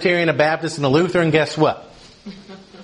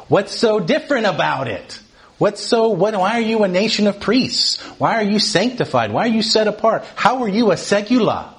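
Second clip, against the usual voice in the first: higher, insistent questioning; mock challenge; 8 semitones higher than usual. New converts questioning fellow believers, mildly indignant challenge. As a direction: imitate a puzzled convert demanding answers.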